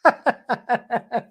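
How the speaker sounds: high burst of real laughter; bright, high laughter. Real, not acted. Laughs after discovery.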